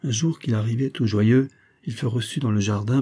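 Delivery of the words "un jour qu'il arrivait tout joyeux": ordinary delivery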